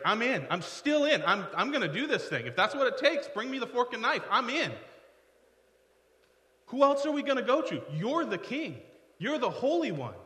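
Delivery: high, emphatic burst of devotion; all-in Peter. Acted. Peter staying while others leave Jesus, high-pitched and insistent. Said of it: "Not Peter. He's like".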